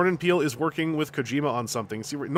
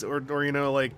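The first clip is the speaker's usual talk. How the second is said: usual speaking voice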